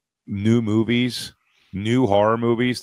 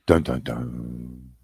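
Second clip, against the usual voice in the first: ominous dun dun dun; 10 semitones lower, 6 dB quieter, much slower than usual. Pretend. Mock horror movie suspense sting.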